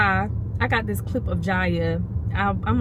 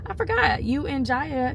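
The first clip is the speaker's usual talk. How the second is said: surprised gasp; 7 semitones higher than usual. Retold or imitated. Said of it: "I was like".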